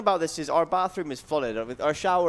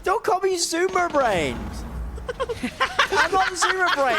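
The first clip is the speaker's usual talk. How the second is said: high-pitched protest; 13 semitones higher than usual. Real, not acted. Protesting nickname.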